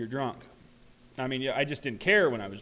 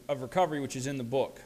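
normal talking voice

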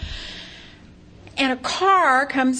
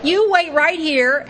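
excited; 8 semitones higher, 5 dB louder, slower than usual. Retold or imitated. Excited, telling stranger to wait.

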